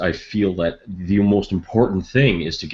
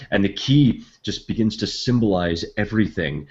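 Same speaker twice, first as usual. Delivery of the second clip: usual speaking voice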